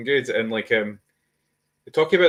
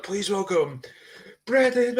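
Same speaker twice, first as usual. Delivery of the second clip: high-pitched announcer imitation; mock introduction, pitched up for the entrance; ring announcer; 10 semitones higher than usual. Fan imitating the announcer welcoming a returning wrestler, announcer voice.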